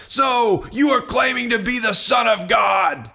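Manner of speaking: high, strained accusing shout; outraged shout. Acted. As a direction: cry out in outrage, pitch way up. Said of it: "And they all shouted".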